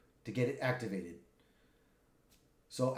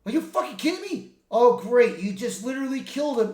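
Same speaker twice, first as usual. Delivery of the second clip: high, loud exasperated outburst; outraged disbelief; 7 semitones higher, 6 dB louder than usual. Retold or imitated. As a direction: blow up at a partner's stupid move. Wolverine furious at the dead Deadpool.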